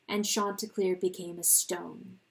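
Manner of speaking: ordinary delivery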